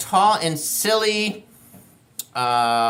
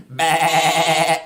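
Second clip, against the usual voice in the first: goat bleat; 6 semitones lower than usual. Pretend. Showing kids the goat sound, low bah. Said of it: "he makes a sound like this".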